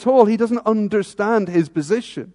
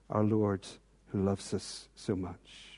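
ordinary talk, nothing marked

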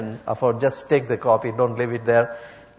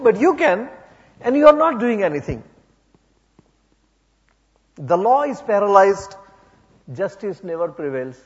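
raised, pleading cry to God, higher and louder; Habakkuk crying out; 6 semitones higher, 3 dB louder than usual. Acted. Prophet cries out to God over injustice, anguished and raised. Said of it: "he's crying out to God, saying".

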